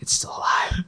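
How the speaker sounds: hushed unvoiced whisper in disbelief. Telling friends how he whispered about the live crab. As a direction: breathe it out like a secret. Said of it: "I'm like".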